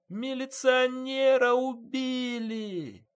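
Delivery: very high, drawn-out wail of alarm; wailing cry. A narrator's reading. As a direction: cry it out slowly to the whole yard, way up high. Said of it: "заголосила".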